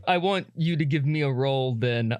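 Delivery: normal talking voice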